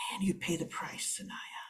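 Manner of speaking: whispered, under the breath; whispered aside. Acted. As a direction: murmur it so she can't hear. Jeff mutters after she leaves.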